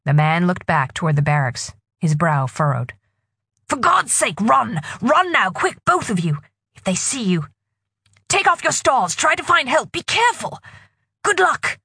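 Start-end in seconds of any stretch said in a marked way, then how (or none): 3.7-11.8 urgent. Acted. Policeman urging girls to flee.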